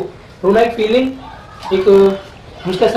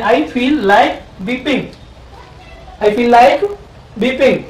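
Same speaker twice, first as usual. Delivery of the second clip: slow teaching drill; much slower than usual. Shown as a demonstration. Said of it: "ऐसे बनेगा".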